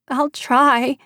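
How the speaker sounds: put-on high, trembling little girl; a scared child trying to be brave. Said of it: "Bryn said in a trembling voice".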